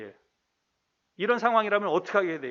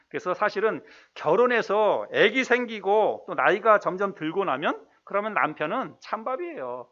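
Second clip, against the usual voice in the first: ordinary delivery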